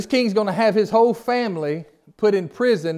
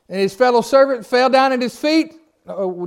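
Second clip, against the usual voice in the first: ordinary delivery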